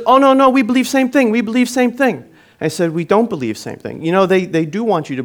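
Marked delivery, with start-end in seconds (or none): 0.0-2.2 high, insistent broken-English imitation; much higher mock accent, eagerly insisting. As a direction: mimic a foreigner protesting in broken English. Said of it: "broken accent".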